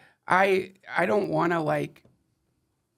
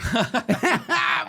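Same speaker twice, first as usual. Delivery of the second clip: bright laugh; 7 semitones higher than usual. Real, not acted. Laughing at the AI story.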